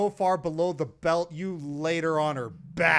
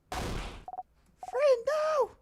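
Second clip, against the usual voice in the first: lightly voiced, higher, pleading; a hint of an alarmed companion; worried girl character; 4 semitones higher, 10 dB quieter, faster than usual. Bonnie questioning her friend about the fall, worried.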